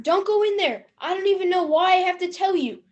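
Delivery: ordinary talk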